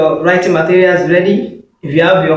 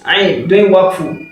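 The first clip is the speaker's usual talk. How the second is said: usual speaking voice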